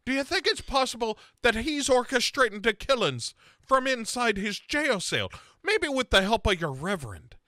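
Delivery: high, rising mock accusation; mock Sarah. Friend mocking Sarah's wild theory, high and rising.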